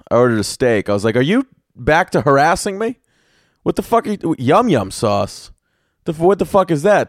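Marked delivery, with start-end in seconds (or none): 1.2-7.1 mock indignation. Retold indignation at waitress's offer.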